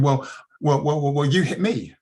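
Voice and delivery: mock blustering counter-accusation; lying driver. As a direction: imitate a driver blustering his way into a lie. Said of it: "the guy started lying".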